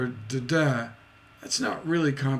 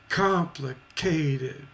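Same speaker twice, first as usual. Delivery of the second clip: Complicated said slowly, stress on Com; stress demo; much slower than usual. Shown as a demonstration. Teacher slowly spelling out stress in Complicated. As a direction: stretch out Complicated, hitting the start. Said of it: "So the stress at the beginning".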